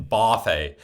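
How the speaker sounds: BUFFET said "BAH-fay"; buffet with an open "ah" first vowel; northern pronunciation. Retold or imitated. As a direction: say buffet as "boff-ay". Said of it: "That's how they say it".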